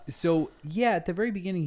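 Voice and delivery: ordinary talk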